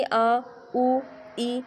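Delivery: आ ऊ ई drawn out one by one as clear separate vowels; vowel sounds recited. Shown as a demonstration. Teacher to pupil, demonstrating आ ऊ ई as separate vowels. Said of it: "इसको भी हम क्या पढ़ेंगे".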